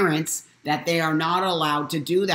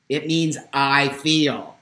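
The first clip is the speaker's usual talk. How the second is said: usual speaking voice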